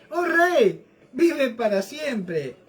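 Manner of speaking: high, ringing call that slows at the end; exultant reply. Acted. Daniel answers the king from the lions' pit, exultant. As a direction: hail the king high, then draw out the wish.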